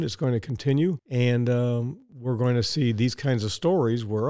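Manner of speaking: normal talking voice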